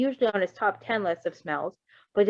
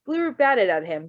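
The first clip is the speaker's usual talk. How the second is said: ordinary delivery, nothing marked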